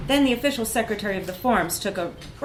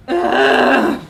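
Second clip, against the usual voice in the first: loud strangled groan of fury; frustrated rage grunt; 7 semitones higher, 11 dB louder than usual. A narrator's reading. Narrator voicing her rage at the word astronaut as a loud ugh. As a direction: spit out a furious guttural ugh. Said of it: "sounded like".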